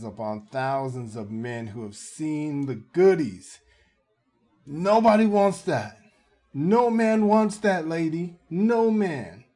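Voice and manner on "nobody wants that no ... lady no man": loud, high-pitched exclamations; emphatic outburst. Real, not acted. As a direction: holler it to drive the point home. Commentator driving home his warning.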